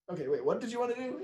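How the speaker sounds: put-on a kid cutting in to beg; mock child voice begging a parent; child whining. Child protesting.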